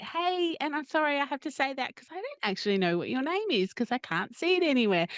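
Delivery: cheeky. Retold or imitated. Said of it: "I get a little bit cheeky".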